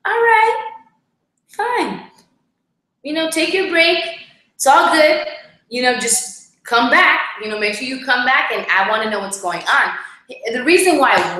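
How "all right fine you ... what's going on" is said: high, breezy "all right, fine", letting him go; mock easygoing. Wife imitating herself letting husband take a break, high and breezy. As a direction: sing it out high as if it's no big deal. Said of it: "I was like".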